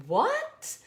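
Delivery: high squeaky disbelieving what; surprised what. Retold or imitated. Reacting to her page likes, a high shocked what.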